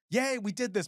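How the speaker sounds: high, celebratory cheer; acted little burst of triumph; mock cheer. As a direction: cheer like a new graduate.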